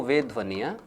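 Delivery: slow, spaced dictation with phrases repeated; dictating notes to a class at writing pace. Acted. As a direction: read it out phrase by phrase for students to copy. Teacher dictating a definition.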